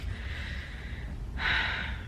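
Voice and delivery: long breathy sigh; whispered sigh. Real, not acted. Sighing as she finds her place. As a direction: let out a soft tired sigh.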